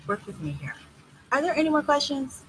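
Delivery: usual speaking voice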